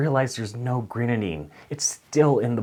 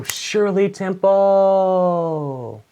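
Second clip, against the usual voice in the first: loud, high, very slow announcement; drawn-out Shirley Temple; 9 semitones higher, 5 dB louder, much slower than usual. Retold or imitated. Waiter proudly presenting the drink, loud and drawn out. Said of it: "And I go".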